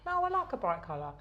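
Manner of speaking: normal talking voice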